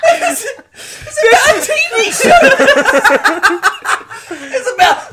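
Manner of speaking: high, loud, laughing disbelief; incredulous laughing. Real, not acted. Mocking a friend's pointless story, squealing.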